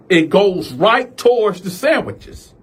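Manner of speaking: ordinary talk, nothing marked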